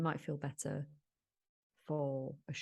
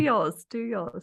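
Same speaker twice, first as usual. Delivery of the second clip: teasing dare; 6 semitones higher, 11 dB louder, much slower than usual. Real, not acted.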